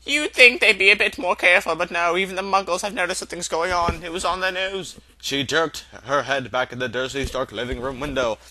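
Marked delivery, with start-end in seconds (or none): 0.0-4.9 higher, lightly voiced stern professor; a hint of a prim, scolding witch; clipped complaint; McGonagall's voice. McGonagall complains about the Muggles.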